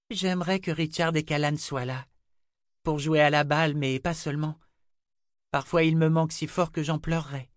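put-on high, wistful girlish voice; little girl Rachel, wistful.

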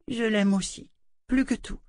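put-on high child voice, heartfelt; a girl declaring her love; loving little girl. A narrator's reading. Rachel aimante. (voix de fillette tendre)

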